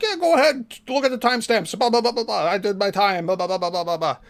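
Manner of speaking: high, sing-song mock of a brushing-off lawyer; mocking dismissive. Man mocking the lawyer brushing off the questions, high and sing-song. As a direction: ham up the lawyer waving it all away. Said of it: "And he's like".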